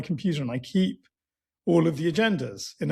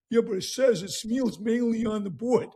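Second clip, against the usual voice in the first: Rocky voice; 3 semitones higher than usual. Retold or imitated. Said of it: "he goes".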